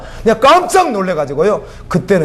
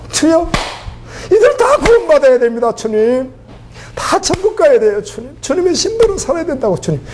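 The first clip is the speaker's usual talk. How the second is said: higher, pleading cry to the Lord; tearful pleading prayer; 10 semitones higher than usual. Retold or imitated. Pastor reenacts weeping prayer for his flock, tearful and high. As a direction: beg God through tears for the congregation. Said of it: "눈물이 왈칵 쏟아지는 거예요".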